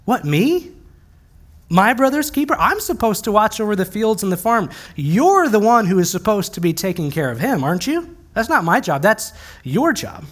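hammed-up little-brother indignation; questioning, indignant. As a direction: push the blame back, acting affronted. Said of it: "Cain is questioning God".

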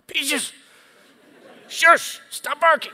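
a hammed-up, shrill-free but high, loud scolding of Peaches; stern voice. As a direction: bark it out like a strict dog owner. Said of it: "you get your stern voice going".

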